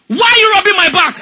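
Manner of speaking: loud, high, exasperated question; irritated shout. Retold or imitated. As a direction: snap at him in pain. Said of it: "after a few seconds".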